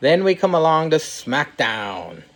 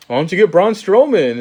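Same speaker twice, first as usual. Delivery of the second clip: Daniel Bryan retort; 5 semitones higher, 4 dB louder than usual. Retold or imitated. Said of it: "but he goes".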